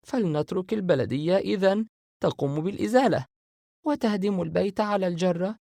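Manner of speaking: Sara voicing her doubts to her brothers; confused Sara. Sara proposes avoiding it.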